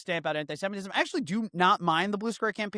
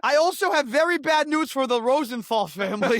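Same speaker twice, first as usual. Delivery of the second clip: mock messenger; 5 semitones higher, 5 dB louder than usual. Telling the family the news, hammed-up herald. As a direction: proclaim the news like a relieved town crier.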